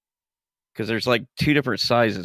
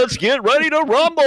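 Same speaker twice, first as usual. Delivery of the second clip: high, loud rising ring announcer call; boxing announcer; 8 dB louder than usual. Retold or imitated. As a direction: belt it out like a fight announcer. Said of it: "You sound like a boxing announcer".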